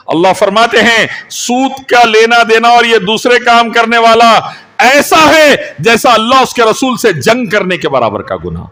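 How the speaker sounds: forceful high sermon delivery; fiery warning. Real, not acted. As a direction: thunder the warning out to the congregation. Warns the congregation.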